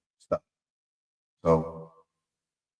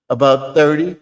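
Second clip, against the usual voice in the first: high, loud little frog; 6 semitones higher, 9 dB louder than usual. Acted. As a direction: blurt it like a proud tiny frog. Frog answers.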